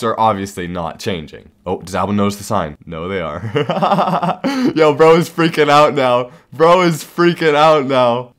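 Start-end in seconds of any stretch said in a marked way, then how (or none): 3.4-4.7 laugh. Real, not acted. Laughing at victim's reaction. 4.7-8.3 excited. Real, not acted. Victim panics, streamer delighted.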